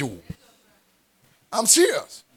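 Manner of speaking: usual speaking voice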